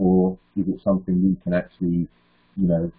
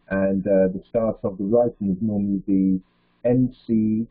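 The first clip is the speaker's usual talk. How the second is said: ordinary delivery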